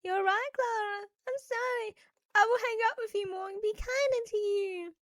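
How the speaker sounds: high tearful apology; crying. Acted. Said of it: "Jane cried as well".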